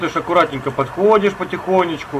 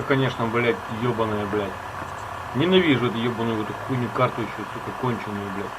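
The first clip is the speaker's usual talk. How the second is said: muttered cursing; 7 semitones lower, 8 dB quieter than usual. Real, not acted. Grumbling alone about the map.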